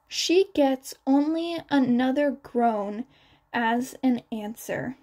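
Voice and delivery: ordinary delivery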